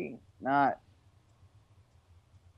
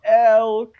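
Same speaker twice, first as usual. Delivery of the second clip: a loud, high elk call; elk bugle; 7 semitones higher, 7 dB louder than usual. Shown as a demonstration. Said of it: "Can you do an elk noise".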